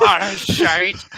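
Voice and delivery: loud, high exasperated cry of Ah, shite; a frustrated cleric yelling as the blow lands; loud Irish curse. Acted. Player voicing his cleric cursing a hit, loud Irish curse.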